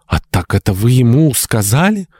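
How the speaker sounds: high rising question, taken aback; shocked, pitch climbing to the end; gasped realisation. A narrator's reading. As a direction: gasp it as the truth sinks in. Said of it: "ахнула Мария".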